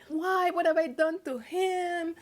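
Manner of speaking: soft, high-pitched plaintive question; anguished disbelief. Retold or imitated. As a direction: plead quietly to yourself, voice lifted high. Retold anguished inner question.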